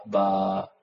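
usual speaking voice

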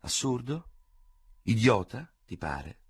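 "Idiota" ripreso con ironia, tono che sale; mock gravity. A narrator's reading. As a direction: rimanda la parola con finta serietà. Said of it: "con maliziosa gravità". ("idiota" ripetuto con maliziosa gravità)

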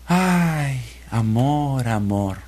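a sad bird's sigh that sinks to a murmur. A narrator's reading. Heartbroken bird sighing for the bird he loves. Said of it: "el pajarito se puso muy triste".

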